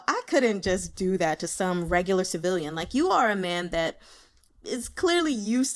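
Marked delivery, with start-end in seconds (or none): none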